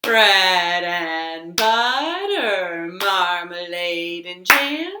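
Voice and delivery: slow, drawn-out nursery chant; singsong rhyme. Acted. Storytime host to children, slow singsong chant. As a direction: chant it slowly for little kids.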